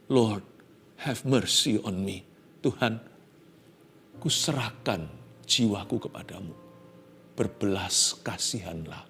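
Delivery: leise, demütige Hingabe; demütig flehend. Acted. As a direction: gib dich ganz leise hin. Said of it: "dengan rendah hati".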